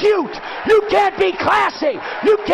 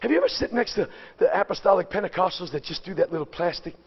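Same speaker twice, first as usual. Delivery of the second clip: hushed mocking aside; 11 semitones lower, 8 dB quieter, much faster than usual. Preacher mocks quiet worshippers.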